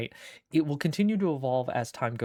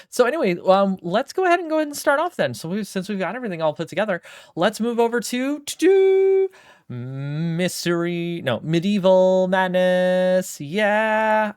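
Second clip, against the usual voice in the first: hyped streamer; 6 semitones higher, 8 dB louder than usual. Real, not acted. Hyped stream start, drawn out.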